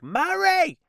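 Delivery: high, loud shouted Murray; Miami couple voice. Retold or imitated. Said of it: "that couple in Miami".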